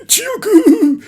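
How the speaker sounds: high, slow stretched shocked cry; anime-style stunned shout; shocked yell. Retold or imitated. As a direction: bellow the number in disbelief. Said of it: "Il dit comme ça".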